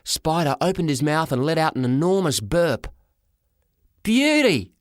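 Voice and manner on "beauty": high, loud admiring yell; excited boy's yell. A narrator's reading. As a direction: yell it high like a thrilled boy. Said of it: "yelled Sean".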